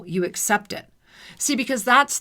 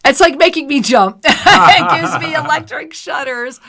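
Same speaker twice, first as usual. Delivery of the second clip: louder, higher, giddy laughing speech; 6 semitones higher, 7 dB louder than usual. Real, not acted. Host jolted by electric shudders, laughing.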